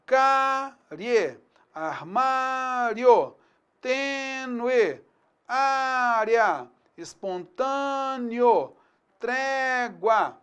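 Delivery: slow teacher dictation of syllables; syllable-by-syllable reading. Shown as a demonstration. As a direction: spell out each syllable for the class. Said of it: "a divisão silábica que a gente pode ter".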